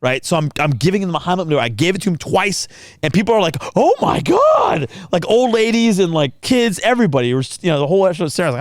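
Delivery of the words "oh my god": high, drawn-out onlooker's exclamation; mock horrified cry. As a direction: imitate a horrified crowd crying out. Voices shocked onlookers in restaurant.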